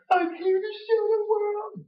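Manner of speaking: high parody voice; mock entrance line. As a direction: squeak it like a parody. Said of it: "And then".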